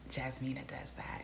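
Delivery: breathy gossiping whisper. Retold or imitated. Vlogger retelling a chat with her cousin, a gossipy whisper. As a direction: whisper it like a secret. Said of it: "So I was just like".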